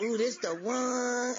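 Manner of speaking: high, slow, swooning mock gush; giddy excitement. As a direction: gush like a smitten woman. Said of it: "she was just all excited".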